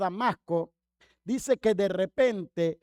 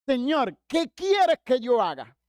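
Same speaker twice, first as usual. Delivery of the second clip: raised, higher-pitched slow appeal; fervent plea; 5 semitones higher, 4 dB louder, slower than usual. Retold or imitated. Believer eager to please God, loud acted plea. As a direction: cry it out to God in mock earnest.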